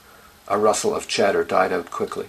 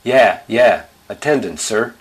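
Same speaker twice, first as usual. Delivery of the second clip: raised, pushy pupil's call; loud classroom clamour; 3 semitones higher, 6 dB louder than usual. A narrator's reading. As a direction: pipe up loudly at the teacher. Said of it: "It got loud".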